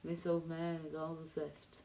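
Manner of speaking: hushed echo of a film monologue; low-key movie-line mimicry; Morgan Freeman. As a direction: softly mimic the movie line, half whispered. Echoing Morgan Freeman's film lines.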